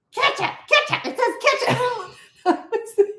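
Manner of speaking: high, exasperated "Ketchup, ketchup"; losing it at the counter; mock berserk. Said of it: "I went berserk".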